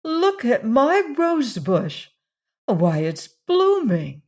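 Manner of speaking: high, slow delighted cry; surprised at the blooming rose bush, a glad exclamation. Acted. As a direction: call it out in glad wonder. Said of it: "gave an exclamation of surprise".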